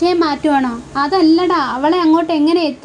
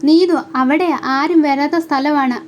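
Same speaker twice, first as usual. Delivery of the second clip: ordinary delivery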